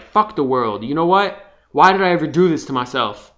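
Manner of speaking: loud, bitter ranting inner voice; acted rage. Venting rage at the system, angry loud rant. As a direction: rant at the world like it owes you. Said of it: "you got hatred and rage".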